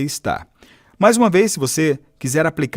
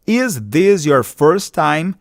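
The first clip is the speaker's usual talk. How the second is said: slow model phrase; 3 semitones higher, much slower than usual. Shown as a demonstration. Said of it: "Essa é a sua primeira vez?".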